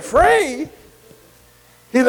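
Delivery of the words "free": high, incredulous repeat of the word; incredulous echo. Retold or imitated. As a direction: echo it back in disbelief. Man surprised at free tape.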